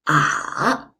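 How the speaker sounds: "ah" dipping low, then rising; falling-rising tone. Shown as a demonstration. Teacher showing third tone, "ah" down then up. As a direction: drop "ah" down, then lift it. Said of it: "goes down first and then rises up".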